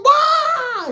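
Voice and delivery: very high, loud mock yelp; imitated squeezed cry. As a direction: wail like someone being crushed. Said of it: "His shit was".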